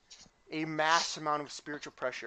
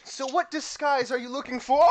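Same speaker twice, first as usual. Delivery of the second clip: high and bright with eagerness; chirpy helpful girl voice; eager shopkeeper girl; 9 semitones higher, 7 dB louder than usual. Acted. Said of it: "And she goes".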